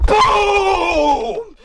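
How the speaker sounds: long, high shouted boom; explosion imitation. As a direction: imitate a huge blast with a drawn-out shout. Imitates the earthquake's blast.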